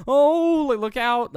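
high, very loud mock warning shout; sudden alarm. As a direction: yell a panicked warning as the character. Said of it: "all of a sudden it changes to".

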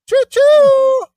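long, high, loud choo-choo; train whistle imitation. Teasing cohost with a drawn-out train whistle.

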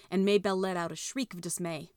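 ordinary delivery

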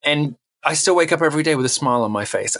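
normal talking voice

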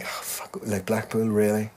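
quiet, unvoiced disbelieving mutter; muttered disbelief. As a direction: whisper it in disbelief. Retold reaction, whispered.

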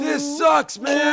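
high, loud complaining shout. Acted. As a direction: yell it as you're swallowed. Being swallowed again.

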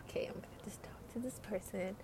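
soft sigh into a whisper; sighing whisper to herself; whispered resignation. Retold or imitated. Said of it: "and then I'm like".

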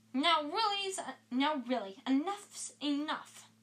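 stern scolding. Acted. McGonagall scolding.

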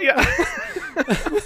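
yeah breaking into laughter; laughing. Real, not acted. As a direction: laugh out the word. Laughing on closing note.

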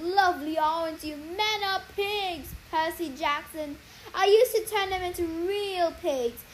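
a smug sorceress savouring her cruelty; gloating sorceress. Acted. Cece mocking the shrunken Percy, gloating sorceress.

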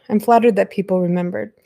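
usual speaking voice

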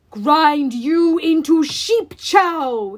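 loud, slow monster threat; raging Cyclops. Acted. Cyclops threatens the fleeing heroes, loud slow roar. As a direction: bellow it like a lumbering giant.

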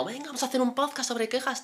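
high, far-off mock voice of his friend; mocking imitation. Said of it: "ahora va a empezar diciendo". (imitación burlona, mucho más aguda)

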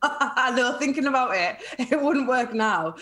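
laughing through the words; can't stop chuckling while agreeing; real laughter. Real, not acted. Host jokes about kids' hands, guest laughs along.

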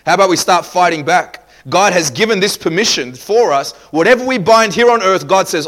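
loud, lifted preacher's rally; forceful preaching. Real, not acted. Preacher rousing the church to fight back, forceful preaching.

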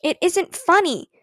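put-on mild shout from a wounded child. A narrator's reading. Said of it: "shouted Ramona".

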